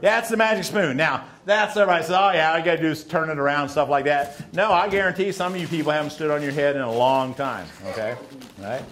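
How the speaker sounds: normal talking voice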